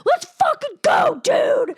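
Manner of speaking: high, loud shrieking dare; crazed challenge. Retold or imitated. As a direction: scream it like you've lost it. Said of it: "I'm just going nuts".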